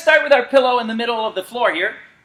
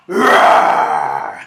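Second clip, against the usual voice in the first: deep, loud shouted roar; hulk roar; 4 dB louder than usual. Acted. Coach joining the Hulk move: loud deep roar. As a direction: let rip a monster roar.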